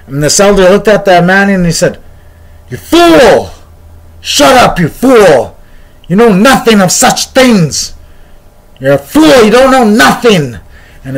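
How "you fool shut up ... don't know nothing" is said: high, loud acted scolding; angry rebuke. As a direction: tell him off as an outraged elder.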